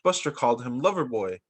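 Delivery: plain reading voice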